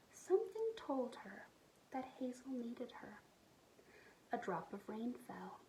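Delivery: soft unvoiced storytelling; hushed narration. A narrator's reading. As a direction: whisper the sad part.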